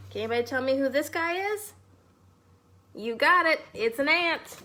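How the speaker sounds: plain reading voice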